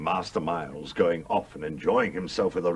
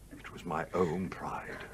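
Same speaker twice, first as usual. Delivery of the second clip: soft whisper of shame; whispered confession; 3 semitones lower, 9 dB quieter than usual. Acted. Older woman confessing her pride to Lucy in a whisper. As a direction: admit it under your breath.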